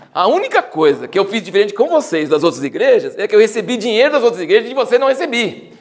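sarcastic Paul asking pardon, laughing; ironic. Retold or imitated. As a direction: play Paul's fake apology for the irony. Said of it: "Isso é ironia".

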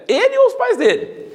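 hammed-up incredulous question; mock disciples' question. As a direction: poke fun at the disciples' logic. Disciples' question mocked, laughing.

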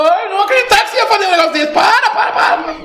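very high, frantic cry to stop; hammed-up shouting. God shouting from heaven to stop Abraham, frantic mock yell. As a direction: yell it in a fast, panicked falsetto. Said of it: "Deus grita lá do céu".

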